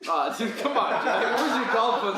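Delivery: high, loud mock-offended laughing; playful laughing comeback; laughing protest. Real, not acted. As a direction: laugh it off and hit back. Protesting a teasing jab.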